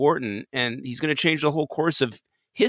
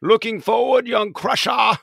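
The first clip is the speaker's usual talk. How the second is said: louder, higher Starfleet captain; 9 semitones higher, 4 dB louder than usual. Acted. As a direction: proclaim it like a captain. Captain voice addressing Wesley.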